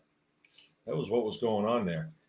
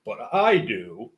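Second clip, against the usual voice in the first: higher and louder, puffed-up know-it-all; mock smug boast; 6 semitones higher, 7 dB louder than usual. Mocking people who brag about secret knowledge, smug. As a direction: lord it over them like a braggart. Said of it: "people say that".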